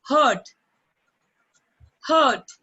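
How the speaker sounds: hurt said loud and clear, falling; teacher drills the word hurt for children; model pronunciation. Shown as a demonstration. Teacher models hurt for the class, loud and clear. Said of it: "See how I'm pronouncing".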